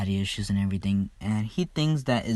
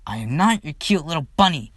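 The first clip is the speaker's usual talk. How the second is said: higher, louder indignant yell; 6 semitones higher, 6 dB louder than usual. Retold or imitated. Mirko yelling after Izuku, indignant shout. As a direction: snap it back across the arena. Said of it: "she just yells".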